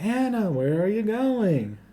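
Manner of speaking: high, slow mock call; calling out, imitated. Sister calls after Anna leaving the party, high mock call.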